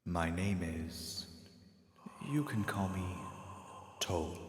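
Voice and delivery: soft, slow, half-whispered stranger; quiet, slow, breathy-free whispered calm; mysterious hooded stranger. Acted. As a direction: hush it like a figure in the dark. Stranger gives his name.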